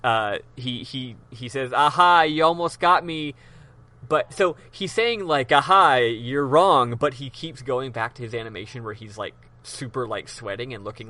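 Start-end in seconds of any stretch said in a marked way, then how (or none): 1.7-3.3 louder mock-triumphant "aha"; desperate bravado. Cornered villain answering the lawyer, mock desperate bravado. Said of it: "Gant finally, desperately". 5.5-6.9 loud, slow mock "aha"; feigned cool bravado. Cornered villain insisting the lawyer is wrong, mock bravado. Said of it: "trying to pretend like it's all cool".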